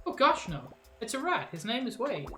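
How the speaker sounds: flustered game hero. Acted.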